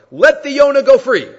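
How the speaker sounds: high, loud acted call. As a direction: call up loudly to the man on your shoulders. Acts out man calling upward.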